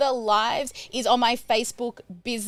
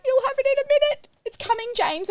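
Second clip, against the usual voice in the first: much higher, fast, sing-song stalling; flustered reassurance; 13 semitones higher, faster than usual. Retold or imitated. Sally stalling her assistant James, high and hurried. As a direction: placate him brightly while scrambling. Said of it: "I'm like".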